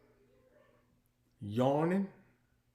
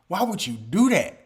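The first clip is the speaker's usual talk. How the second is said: ordinary talk, nothing marked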